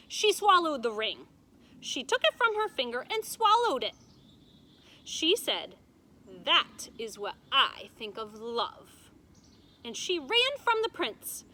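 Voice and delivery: loud, high, building dramatic telling; dramatic storytelling. A narrator's reading. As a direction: make the twist land big. Grandmother dramatizes shocking twist.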